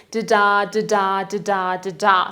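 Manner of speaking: steady unstressed-stressed pulse, each "da-da" rising in weight on its second "da"; iambic beat. Shown as a demonstration. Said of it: "one unstressed syllable followed by a stressed".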